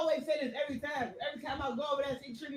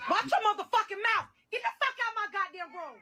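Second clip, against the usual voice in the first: angry yelling; 5 semitones higher than usual. Retold or imitated. Same clip replayed.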